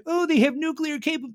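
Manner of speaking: mocking whine. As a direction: parody their fear, cut off mid-word. Mocking nuclear worry.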